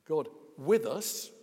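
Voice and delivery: slow down and lean on with; stress on WITH. Shown as a demonstration. Preacher showing the congregation which word to stress, stress on with. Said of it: "if you stress the word".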